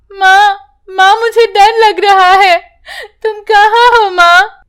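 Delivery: put-on a scared girl calling in the floodwater; frightened child. (डरी हुई, रोती बच्ची की ऊँची पुकार)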